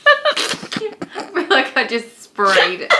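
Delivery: slow, high, laughing; cracking up while speaking. Real, not acted. Laughing after fart attempt.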